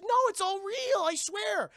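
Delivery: very high, loud protest; desperate insistence. Retold or imitated. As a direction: plead at a squeal, imitating her. She swears to doubting boys.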